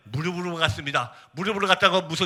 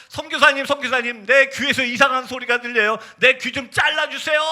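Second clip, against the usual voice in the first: retold panicked plea, higher pitch; 괴로운 외침 흉내; 7 semitones higher, 3 dB louder than usual. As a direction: imitate a tormented youth begging for help. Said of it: "귀를 막고 막 소리 지르면서". (괴로워하며 애원하는 청년 흉내)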